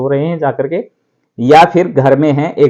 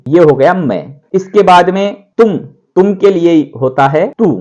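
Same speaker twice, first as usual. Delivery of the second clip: usual speaking voice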